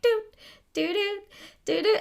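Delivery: high sung piano notes; piano imitation. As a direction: mimic the piano intro, high and bouncy. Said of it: "the guy playing the piano is like".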